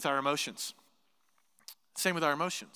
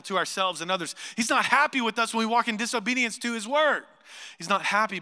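loud, insistent refrain; emphatic preaching; 4 semitones higher, 5 dB louder than usual. Real, not acted. Preacher warning congregation about sin, emphatic and loud. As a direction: hammer each repeated warning at the congregation.

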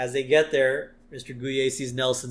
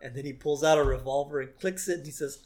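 normal talking voice

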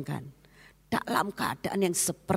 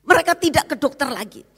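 higher, louder, fast sermon voice; higher, fast preaching; fervent preaching; 10 semitones higher, 8 dB louder, faster than usual. Acted. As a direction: press it forward fast. Preaching continues.

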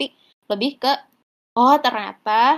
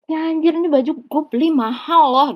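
higher and louder, indignant; offended outburst; 5 semitones higher, 4 dB louder than usual. Retold or imitated. Offended partner defending her expensive outfit, indignant. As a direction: bristle at the insult to your outfit. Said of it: "Sedangkan kita tuh kayak".

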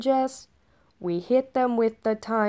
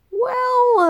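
dramatic high well; 14 semitones higher, 8 dB louder than usual. Acted. Long story opener.

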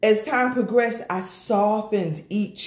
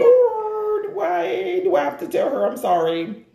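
whiny complaint to God; 8 semitones higher than usual. Retold or imitated. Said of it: "mumbling to myself".

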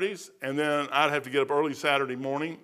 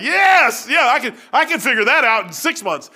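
mock-eager, much higher and louder; gleeful yes; 6 semitones higher, 6 dB louder than usual. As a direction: jump at the offer like a kid. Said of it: "I was like".

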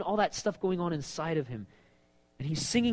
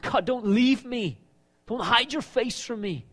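crying out; 6 semitones higher, 4 dB louder than usual. Retold or imitated. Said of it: "he's crying out to God".

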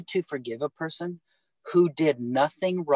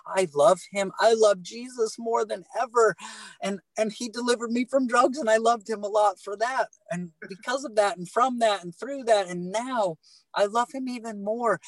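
raised, impassioned pitch climbing higher; fervent declaration; 7 semitones higher than usual. Real, not acted. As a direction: pour out your love for Jesus, lifting the voice. Passionate testimony of love for Jesus.

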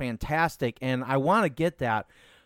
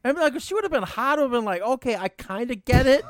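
excited rant; 11 semitones higher, faster than usual. Real, not acted. Excited rant about photo.

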